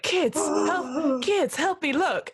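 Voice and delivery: high, slow mock-panicked call to the kids; mocking plea. GM mocks the player's plea to the kids. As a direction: parrot her plea back in a whiny squeak. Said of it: "So you're like".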